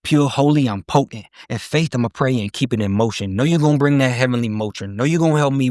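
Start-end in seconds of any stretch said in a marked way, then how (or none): none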